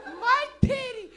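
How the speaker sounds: very high, loud, drawn-out mock wail; slow frantic scream, imitating mom; wound-up mother. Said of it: "she was so wound up".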